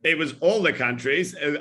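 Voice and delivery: normal talking voice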